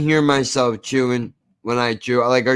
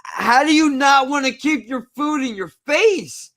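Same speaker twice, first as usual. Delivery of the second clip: exasperated high disbelief; 10 semitones higher than usual. Acted. Mocking disgust at loud eater.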